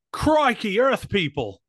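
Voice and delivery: high, loud hammed-up Australian hail; Australian accent. Comic as Sydney greeting aliens, exaggerated Australian accent. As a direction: exclaim it like a cartoon Australian. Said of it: "Sydney, Australia".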